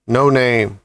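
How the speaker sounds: a lightly voiced big, hearty pig; a hint of a hearty uncle pig. A narrator's reading. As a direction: boom it out like a jolly old pig. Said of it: "in a big, hearty voice".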